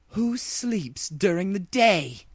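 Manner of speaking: high, slow royal grumble; irritated princess muttering; muttered. A narrator's reading. Luna grumbling after waking, high and slow. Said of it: "she muttered".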